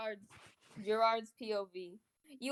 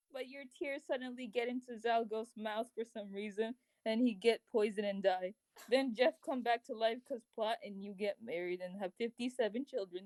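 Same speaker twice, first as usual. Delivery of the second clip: ordinary delivery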